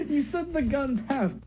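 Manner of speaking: high voice breaking with laughter; talking through laughter. Real, not acted. Teasing a friend's failed accent, laughing. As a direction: squeeze the words out while laughing.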